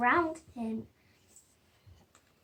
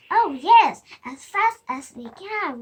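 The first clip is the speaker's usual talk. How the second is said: eager; 10 dB louder than usual. Acted. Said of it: "repeated the children".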